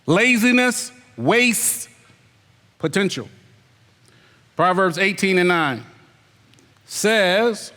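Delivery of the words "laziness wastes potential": Laziness wastes potential drawn out slowly, every word spaced; slow emphatic point. Shown as a demonstration. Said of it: "The second one is laziness wastes potential".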